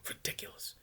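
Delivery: ordinary delivery, nothing marked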